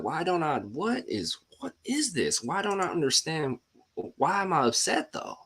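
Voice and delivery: mock his own past frustration; imitating his upset self. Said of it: "I was getting upset".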